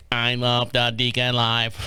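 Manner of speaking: low, slow mock robot. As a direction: drone it out like a machine. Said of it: "make sure you're not a, a robot".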